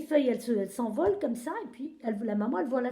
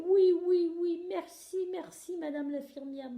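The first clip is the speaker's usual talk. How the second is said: petite tortue reconnaissante; 6 semitones higher than usual. A narrator's reading. Lola remercie, soulagée.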